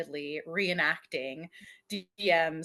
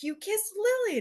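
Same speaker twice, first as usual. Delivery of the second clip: high, sing-song teasing accusation; 13 semitones higher than usual. Retold or imitated. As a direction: point the finger up high and playful. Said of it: "D M approaches Teller and is like".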